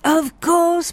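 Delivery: high, mock-bright of course; sarcastic exclamation. A narrator's reading. Michel mocking the American dream, sarcastic and light. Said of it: "Michel exclaimed with sarcastic levity".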